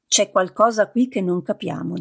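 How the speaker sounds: usual speaking voice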